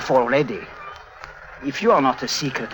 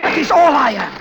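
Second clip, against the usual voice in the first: high and loud, hammering each word; furious insistence; 11 semitones higher, 5 dB louder than usual. Acted. Clown shouting at Harry, furious insistence. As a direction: insist in rage that he is only a clown.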